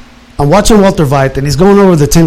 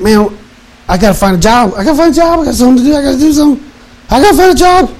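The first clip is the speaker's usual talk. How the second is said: frantic, much higher voice, rising to a near cry; desperate, pitched-up self-quote; panicked meltdown; 9 semitones higher, faster than usual. Retold or imitated. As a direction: spiral into panic about finding work. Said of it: "I had literally had a meltdown".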